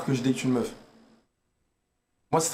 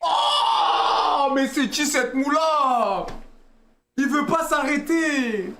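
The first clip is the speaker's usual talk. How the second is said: amazed shout; 10 semitones higher, 4 dB louder, much slower than usual. Real, not acted. Reacting to a passerby's outfit.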